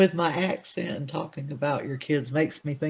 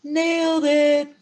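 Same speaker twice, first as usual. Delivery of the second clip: high, slow singsong nailed it; drawn-out echo; 12 semitones higher, much slower than usual. Acted. Co-host echoing the host's triumph in a slow singsong. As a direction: stretch it out playfully, high.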